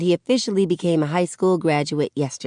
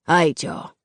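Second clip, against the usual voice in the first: suddenly serious, understated; serious all of a sudden. A narrator's reading. Daddy dismisses the gang members, suddenly serious. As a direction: drop the joking and get down to business. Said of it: "serious all of a sudden".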